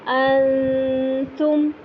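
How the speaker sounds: انتم with long hidden nasal noon; very slow Quranic انتم, noon hummed near ta; ikhfa recitation. Shown as a demonstration. Teacher reciting ikhfa example slowly. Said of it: "نون پورا نہیں لگانا".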